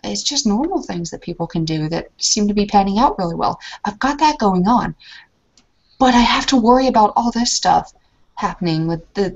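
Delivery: ordinary talk, nothing marked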